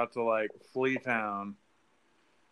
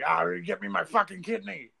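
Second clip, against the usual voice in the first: higher-pitched hammed-up angry demand; Mick Foley; 6 semitones higher than usual. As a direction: imitate a wrestler demanding it, half laughing. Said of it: "same with Mick Foley being like".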